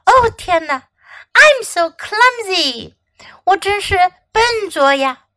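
high-pitched fretting; dismayed. A narrator's reading. Raisin apologetic after nearly hitting Fox, dismayed. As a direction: wail mildly over your own clumsiness.

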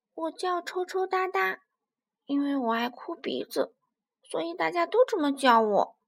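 put-on high childlike character voice; crybaby little dinosaur. Little spinosaurus telling the big dinosaur its nickname, high childish voice. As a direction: introduce yourself as a small child would.

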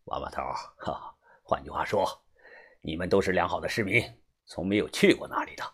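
low, soft conspiratorial fixer; sly, knowing chuckle. A narrator's reading. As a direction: chuckle quietly, then hint in a low voice. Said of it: "中年男人神秘的一笑". (神秘地低声一笑，话里有话)

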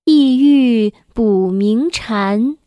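loud, slow, measured classical poem reading; stately, loud children's poem recital; poem recitation. Acted. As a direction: recite it slowly with full projection, drawing out each line.

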